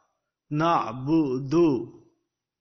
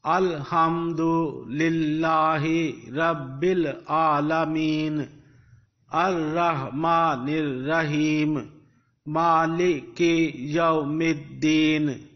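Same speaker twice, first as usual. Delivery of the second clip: syllable by syllable. Shown as a demonstration. Teacher models the verses for learners, syllable by syllable. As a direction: chant each syllable long and apart. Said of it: "in syllables, you will pronounce it".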